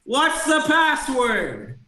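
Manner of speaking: high, drawn-out shouted demand; yelling creature. Acted. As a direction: yell it out as a little redcap, stretching it. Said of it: "one of them yells out".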